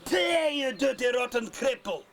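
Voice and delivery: bullying shout. Acted. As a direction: bark the insult down the street. Butcher abusing Hooky again.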